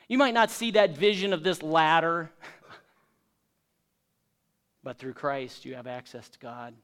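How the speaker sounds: ordinary talk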